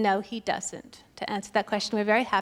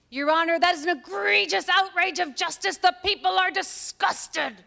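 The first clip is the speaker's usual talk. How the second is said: mock the lawyer's outraged closing; Marcia Clark impression; 5 semitones higher than usual. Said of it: "Have you seen her?".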